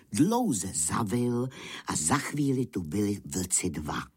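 ordinary delivery, nothing marked

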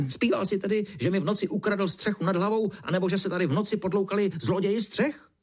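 high, indignant rant; squawky, worked-up questioning; sputtering beetle. A narrator's reading. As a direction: sputter out accusations, high and cross. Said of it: "brouk prskal dál".

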